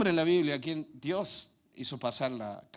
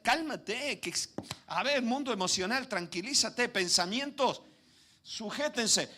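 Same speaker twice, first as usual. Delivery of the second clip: higher, firm calming commands; commanding the soul; 8 semitones higher, 3 dB louder, slower than usual. Acted. David speaks sternly to his own soul. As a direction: order the mind to settle.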